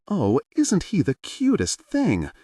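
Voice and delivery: slow, high delighted squeal; gushing over a newborn, high and drawn out. A narrator's reading. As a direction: coo over the baby. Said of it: "Hiyori squealed".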